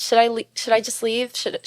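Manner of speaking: usual speaking voice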